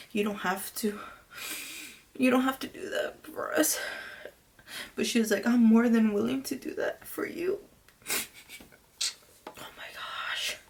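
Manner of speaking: soft, tearful, halting words; choked up, fading toward a whisper; crying. Real, not acted. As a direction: struggle through with real tears, pausing to recover. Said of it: "why am I crying".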